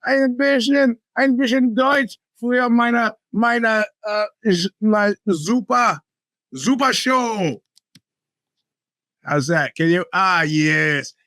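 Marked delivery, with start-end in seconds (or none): 0.0-7.6 mock German accent, a little higher and slow; playful fake German accent. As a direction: ham up a German accent for the listeners. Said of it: "how bad my German accent is".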